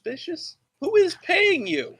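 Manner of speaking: high, incredulous accusing; mock outrage. Real, not acted. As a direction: act shocked and accusing.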